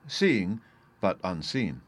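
plain reading voice

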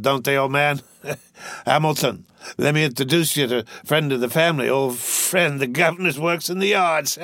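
put-on slurred, giggly drunk rich boy. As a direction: play a rowdy drunk young gentleman. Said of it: "I'm drunk".

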